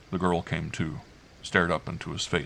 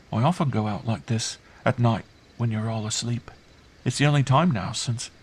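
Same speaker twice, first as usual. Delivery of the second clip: put-on high, earnest little-child voice; confiding child; 4 semitones higher than usual. Girl confiding night outings to her uncle, earnest.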